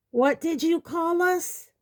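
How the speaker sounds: high, outraged centaur cry; indignant shout. A narrator's reading. Centaur insulted by a human, indignant high shout. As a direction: shout it as an affronted challenge.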